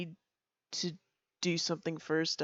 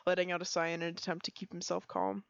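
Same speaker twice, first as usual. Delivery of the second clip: ordinary talk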